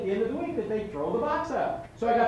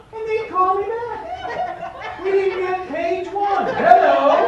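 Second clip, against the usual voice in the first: higher and much louder, laughing through the words; 9 semitones higher, 10 dB louder, slower than usual. Real, not acted. Telling the audience about the callback, laughing and loud. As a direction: keep laughing as you tell it.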